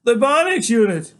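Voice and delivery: loud, high, exasperated answer; upset insistence. A narrator's reading. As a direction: fire it back, demanding the truth.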